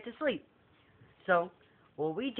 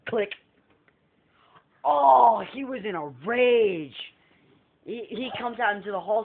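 ordinary talk

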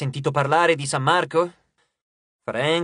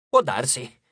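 a low, offhand maybe; indifferent shrug; 4 semitones lower, slower than usual. Acted. Host shrugs off Frank's plea, low and offhand. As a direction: toss it away without caring.

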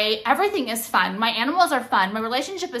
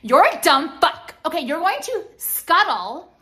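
loud, raised scolding; fed-up angry telling-off; pissed off; 5 semitones higher, 4 dB louder than usual. Real, not acted. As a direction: lash out at an offender. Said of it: "Now I'm pissed".

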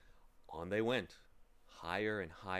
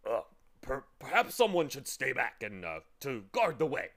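a lightly voiced glum bug, hesitant and louder; unhappy bug; 3 semitones higher, 8 dB louder than usual. Gloomy bug offering to guard the way, hesitant voice. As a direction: offer to stay back with a mild reluctant stammer. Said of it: "said the unhappy bug".

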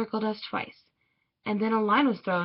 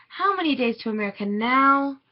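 put-on higher, louder child's question; little sister asking; 4 semitones higher, 6 dB louder than usual. A narrator's reading. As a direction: ask it as an eager little sister, pitched up. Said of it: "my little sister asked".

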